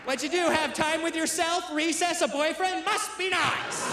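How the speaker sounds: high mocking questions into a stretched-out jeer; high, spiteful sarcasm, dragging out the last words; spiteful wife. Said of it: "just spite visit me".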